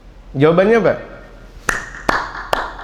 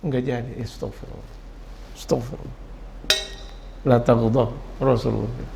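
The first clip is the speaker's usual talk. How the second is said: softer, lower hushed astagfirullah; muttered restraint; 5 semitones lower, 10 dB quieter than usual. Retold or imitated. Preacher mimics a man holding back anger, hushed. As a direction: swallow the anger under your breath.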